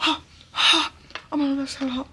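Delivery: gasping, high, mouth burning from hot food; burnt mouth. Real, not acted. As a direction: gasp out as the fries scald you. Burns mouth on hot fries.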